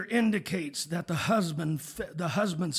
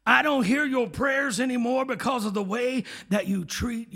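I don't hear your prayers anymore, pushed up louder and higher; louder, higher restatement; 6 semitones higher, 6 dB louder than usual. Shown as a demonstration. Preacher restating God's words plainly for the congregation, louder and higher. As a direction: drive it home the second time. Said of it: "Common English".

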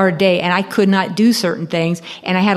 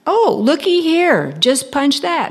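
much higher, singsong salesgirl; sweet clerk voice; 8 semitones higher than usual. Retold or imitated. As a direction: coo it like a chirpy shop girl. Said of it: "she says".

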